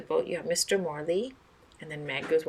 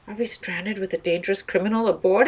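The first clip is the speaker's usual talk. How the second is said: alarmed; 2 semitones higher, 7 dB louder than usual. Acted. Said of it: "exclaimed Missus Maxwell".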